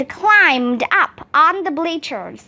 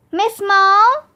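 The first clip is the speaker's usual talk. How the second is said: higher, questioning call across a gym; searching call; 5 semitones higher than usual. A narrator's reading. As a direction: call out for someone, pitch rising. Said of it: "called Miss Daisy".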